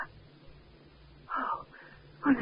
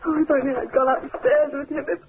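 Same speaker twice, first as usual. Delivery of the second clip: breaking up, crying the regret out; distraught sobbing; 4 semitones higher than usual. Acted. Wife blaming herself after finding her husband, through tears. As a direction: sob it, voice giving way.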